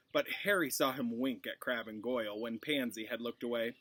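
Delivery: ordinary delivery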